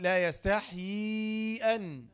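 slow, drawn-out chanted recitation of the verse; Quran recitation. Shown as a demonstration. Teacher recites the verse for students, slow chant. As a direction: recite it slowly as from the mushaf. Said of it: "في القرآن".

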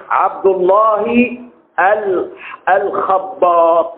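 slow dictation. Shown as a demonstration. Teacher dictating a name slowly so students write it. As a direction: stretch عبد الله الخباط out slowly. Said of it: "اللي بيخبط ورق الشجر".

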